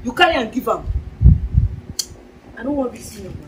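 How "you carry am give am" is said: usual speaking voice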